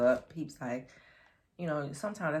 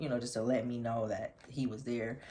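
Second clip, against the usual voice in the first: normal talking voice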